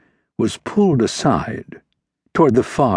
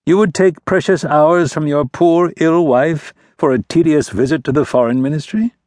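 higher, louder, sardonic aristocrat; mocking prince; 7 semitones higher, 3 dB louder than usual. A narrator's reading. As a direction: needle him with false concern. Prince mocks the baron.

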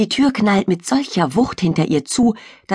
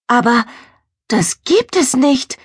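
astonished; 7 semitones higher than usual. Acted. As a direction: gasp it out in disbelief. Girl sees strange creature.

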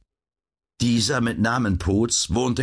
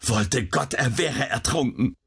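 restrained despairing outcry; a mother's contained despair; desperate; 5 semitones higher than usual. A narrator's reading. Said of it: "rief die Frau verzweifelt".

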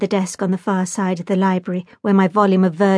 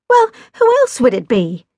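exasperated retort, pitched up and louder; 4 semitones higher, 5 dB louder than usual. A narrator's reading. Jack demands an answer of his cousin, exasperated.

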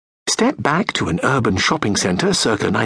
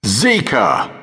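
ordinary talk

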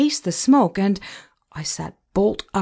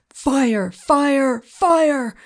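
high fire alarm call, climbing with each cry; yelling; 7 semitones higher, 4 dB louder, much slower than usual. A narrator's reading. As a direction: yell as if warning people upstairs. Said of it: "Joe the cook was yelling".

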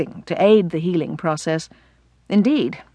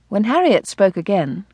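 usual speaking voice

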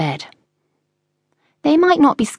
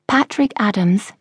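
ordinary delivery, nothing marked

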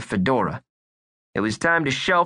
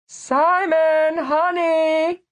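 high, sing-song call upstairs; mother calling from downstairs; mom calling out; 3 dB louder, much slower than usual. Acted. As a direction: call up to your son sweetly.

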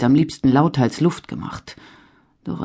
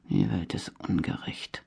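leise gemurmelt, fast geflüstert; murmelnd; 6 semitones lower, 11 dB quieter than usual. A narrator's reading. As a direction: vor sich hin murmeln, kaum hörbar. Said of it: "murmelte der junge Mann". (verbittert vor sich hin gemurmelt)

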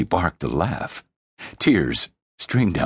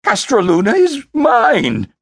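much higher, loud tantrum shout; anguished shout; 7 dB louder than usual. Acted. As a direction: howl it like a spoiled child. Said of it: "raw, anguished howl".

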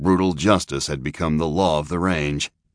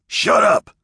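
louder, whispered harsh snap; snapped; 6 dB louder than usual. A narrator's reading. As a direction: cut him off with a hard snap. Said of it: "snapped Price".

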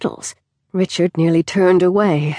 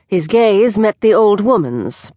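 usual speaking voice

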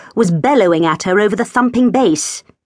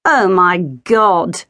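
slow, scornful mutter; much slower than usual. A narrator's reading. Geraldine muttering at Sandy's ring, scornful. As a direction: drag it out with contempt. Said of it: "she muttered".

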